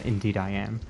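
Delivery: usual speaking voice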